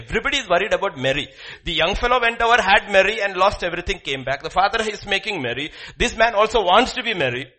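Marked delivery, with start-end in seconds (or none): none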